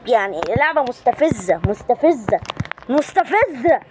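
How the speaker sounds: ordinary talk, nothing marked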